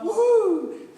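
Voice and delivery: very high, loud held woohoo; excited whoop. Real, not acted. Teacher cheering first violins' proud moment, high loud whoop. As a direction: let out a big ringing whoop.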